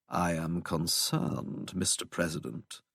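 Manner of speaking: low, soft, very slow English drone; droning English accent. A narrator's reading. Unintimidated visitor to the looming president, quiet English drone. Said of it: "droned in that ridiculous English accent".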